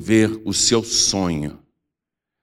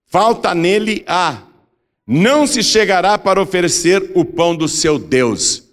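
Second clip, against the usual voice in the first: scripture proclamation; 7 semitones higher than usual. A narrator's reading. Preacher reading Leviticus on future priests, solemn proclamation. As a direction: proclaim the law phrase by phrase, higher. Reads scripture aloud to congregation.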